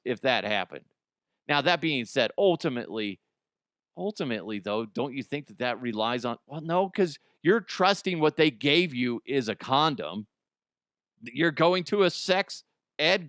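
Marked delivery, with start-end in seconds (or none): none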